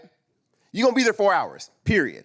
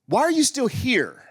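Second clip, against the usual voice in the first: slow, exasperated retold demand, pitched up; going in on her; 6 semitones higher, slower than usual. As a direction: lay into her, drawing out the words. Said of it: "I'm going in on her".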